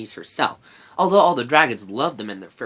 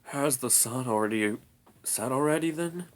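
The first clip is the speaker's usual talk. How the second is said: drowsy, unhurried murmur; lightly voiced sleepy dragon; 4 dB quieter than usual. A narrator's reading. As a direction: ask as if half awake. Sleepy dragon just waking.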